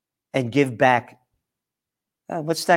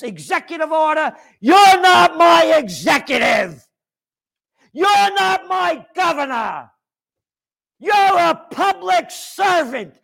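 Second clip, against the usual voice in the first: furious shout; 8 semitones higher than usual. Real, not acted. Fed-up host yells at governors, real furious shout. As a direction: bellow it at the politicians, enraged. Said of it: "I am mad".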